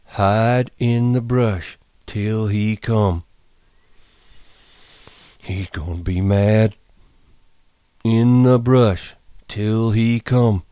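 very slow, careful recital. Acted. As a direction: recite it to himself slowly. Lennie repeating George.

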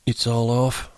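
put-on mildly sulky old man. A narrator's reading. Candy asks George if it's over, sulkily. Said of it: "Candy asked sulkily".

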